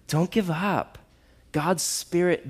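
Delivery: ordinary talk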